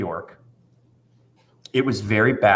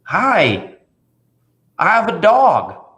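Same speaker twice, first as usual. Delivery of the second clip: put-on nervous little boy; 7 semitones higher, 4 dB louder, slower than usual. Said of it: "he was a little bit nervous".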